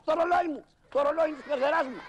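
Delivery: loud, high cry over the watch; panicked cry. Acted. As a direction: shriek it out, grabbing back what's yours. Snatching the watch back, panicking. (πανικόβλητη φωνή, δυνατά και ψηλά)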